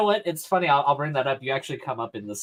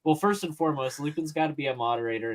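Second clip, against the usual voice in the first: normal talking voice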